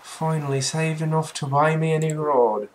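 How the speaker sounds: high, loud Irish brogue; Irish accent. Acted. Fisherman Willy tells the newcomer about his new rod, Irish accent. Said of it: "He is Irish".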